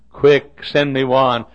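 loud, hurried-sounding order; loud, impatient request; urgent order. Retold or imitated. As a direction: fire off the order with sudden force. Urgent retold order to room service.

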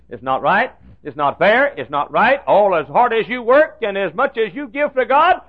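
rising outrage at the unfairness; mad complaint. Retold or imitated. Secretary protesting to the pastor, mock indignant and loud. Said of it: "She got mad at God".

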